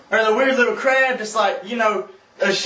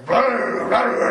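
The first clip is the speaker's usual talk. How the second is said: slow buzzing brr sounds; imitated ringing; 4 semitones higher, much slower than usual. Slow brr buzzing for a strange noise heard outside.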